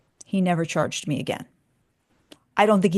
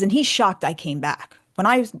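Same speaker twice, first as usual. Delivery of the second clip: normal talking voice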